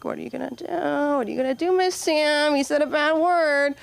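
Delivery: put-on high, whiny kids tattling to teacher. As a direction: kids calling out Miss Sam. Kids tattle on swearing kid.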